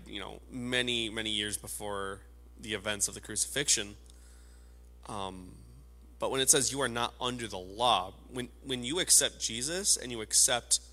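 ordinary talk

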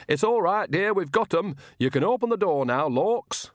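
put-on high-pitched coaxing old countrywoman; coaxing Nanny figure. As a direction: coax them to open the door, pitched well up. Narrator voices Nanny through the door.

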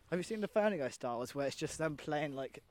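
ordinary talk

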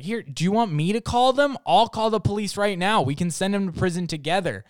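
mom's defiant challenge. Retold or imitated. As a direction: wave the phone at them and call their bluff. Mom threatens to call police.

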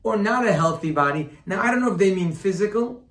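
loud, high-pitched sermon delivery; impassioned preaching. Real, not acted. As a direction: drive the teaching home forcefully. Preaching at full force.

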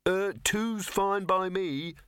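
mild, high, slow call-out. A narrator's reading. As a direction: call it out slowly, pitched high. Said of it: "Victor called out".

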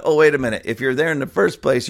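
normal talking voice

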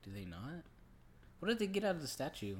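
normal talking voice

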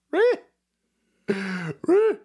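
short, very high squeal; high mock screech. Joking with viewers about Lego fish, high mock screech. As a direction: squeak out a piercing little noise. Imitated screech while laughing.

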